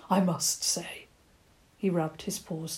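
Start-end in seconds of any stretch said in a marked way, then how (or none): 0.1-1.1 whispered, secretive old badger. Badger whispering the secret of wisdom to the children. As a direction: let them in on a secret.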